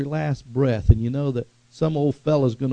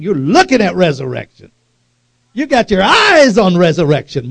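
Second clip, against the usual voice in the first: loud, raised-pitch pulpit cry; impassioned preaching shout; 5 semitones higher, 10 dB louder than usual. Real, not acted. Preacher urges Martha to look at resurrection, impassioned shout. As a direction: belt it out at full preaching force.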